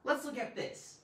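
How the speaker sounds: usual speaking voice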